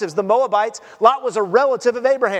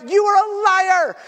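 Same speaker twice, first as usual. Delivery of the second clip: high, raised-voice accusation; accusing shout; 8 semitones higher than usual. Acted. Acts out people's answer to denial.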